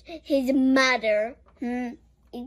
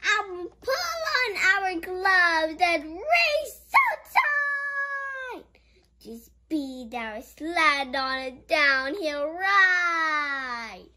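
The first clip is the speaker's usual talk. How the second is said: poem reading; 4 semitones higher than usual. Acted. Reads poem in book.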